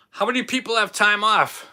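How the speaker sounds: cheerful call-out. Real, not acted. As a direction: call it out to the whole room. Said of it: "am I yelling?".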